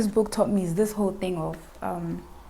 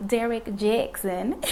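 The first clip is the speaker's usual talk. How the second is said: slow, drawn-out American "Derek Jackson"; American accent; slower than usual. Shown as a demonstration. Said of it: "that's my American accent".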